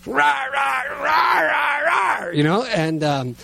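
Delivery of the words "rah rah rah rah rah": high, hammed-up "rah rah" raging noise; angry mock ranting. Dad imitating his own stomping fit, angry mock ranting. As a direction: rage wordlessly in a high mock shout. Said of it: "I am just angrier than angry".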